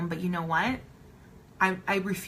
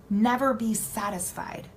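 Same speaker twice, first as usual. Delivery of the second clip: usual speaking voice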